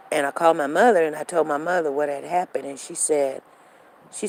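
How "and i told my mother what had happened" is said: ordinary delivery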